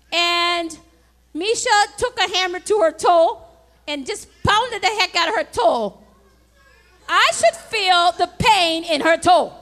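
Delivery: loud preaching with the toe example hammered home; full-force preaching. Acted. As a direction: drive the point like a pulpit climax.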